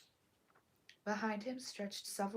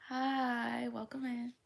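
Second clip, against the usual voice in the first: cheerful greeting; 4 semitones higher, 5 dB louder, slower than usual. Real, not acted. Greeting a newcomer.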